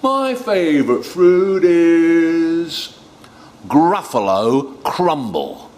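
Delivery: slow, loud, drawn-out announcement; high, booming storybook mouse boast; menacing mouse. Acted. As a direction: stretch it out as a grand threat.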